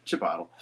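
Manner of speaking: Chipotle as "chuh-POT-ul"; ironic mispronunciation. Shown as a demonstration. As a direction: make Chipotle rhyme with bottle. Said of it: "I started saying Chipotle ironically".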